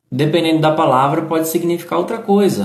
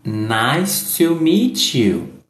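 slow, careful model line; slow demo read; slow model phrase; much slower than usual. Shown as a demonstration. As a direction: spell it out slowly for the learner. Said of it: "teacher models the phrase slowly".

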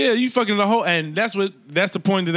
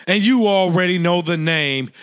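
usual speaking voice

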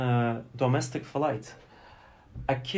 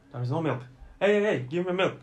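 demanding passenger; 4 semitones higher, 3 dB louder, faster than usual. Retold or imitated. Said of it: "take a sip from the tea and say".

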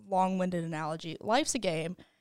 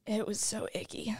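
whispering; 3 dB quieter than usual. Acted. Whispered reply.

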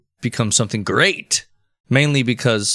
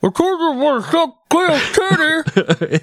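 much higher, slow goofy character voice; squeaky joke voice pushed way up; silly put-on voice; 3 dB louder, slower than usual. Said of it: "What was that?".

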